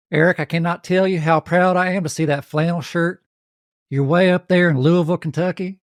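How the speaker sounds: turned-up Southern country twang. Pretend. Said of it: "Let me turn the twang up".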